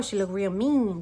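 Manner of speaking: ordinary delivery, nothing marked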